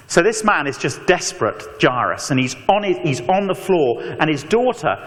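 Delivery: ordinary talk, nothing marked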